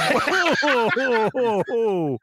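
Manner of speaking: high gleeful ho-ho-ho laugh; jolly ho ho. Real, not acted. Cheering at the promise of cake, gleeful ho-ho. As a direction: chortle with delight at the cake.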